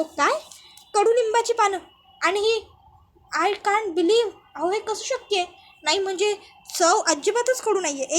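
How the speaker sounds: can't believe what he just ate; shocked disbelief. Acted. Malhar stunned to hear the chutney is neem leaves, loud disbelief.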